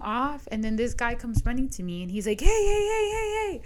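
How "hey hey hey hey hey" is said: sharp, much higher, louder hey hey; park worker yelling hey at her; calling out. Retold or imitated. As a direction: shout to stop someone across the field.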